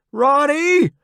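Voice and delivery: high, loud shout; calling out. A narrator's reading. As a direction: call out for a missing comrade. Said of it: "I call out for him".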